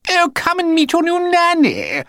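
high, loud sing-song call; shouting posh mother. A narrator's reading. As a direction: call the children cheerily. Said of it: "shouted to Tristram and Candy".

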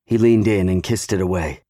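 usual speaking voice

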